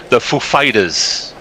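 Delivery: slow, lightly voiced Walken drawl; a hint of Walken's odd cadence; Christopher Walken voice. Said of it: "as Christopher Walken calls them".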